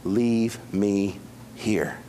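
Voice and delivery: a lightly voiced deacon in heaven, softly declining to return; content departed deacon. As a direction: gently refuse to come back, settled and at rest. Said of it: "what Brother Donald would have said".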